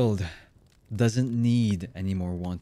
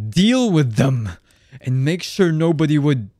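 raised, loud command; angry barked order; 6 semitones higher, 7 dB louder than usual. Acted. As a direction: shout the order in anger. Villain orders underlings.

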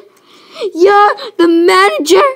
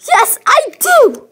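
angry retort; 9 semitones higher, 4 dB louder than usual. Acted.

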